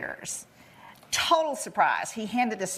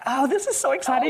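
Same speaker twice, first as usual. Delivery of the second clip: mock excitement; 5 semitones higher than usual. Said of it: "I'm like".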